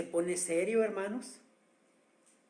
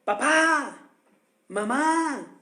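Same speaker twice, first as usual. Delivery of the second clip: put-on high, loud call of a frightened lost child; lost child shouting for his parents; lost child's cry; 6 semitones higher, 7 dB louder, much slower than usual. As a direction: cry out for papa and mama like a lost little boy. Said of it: "Y ese grito es".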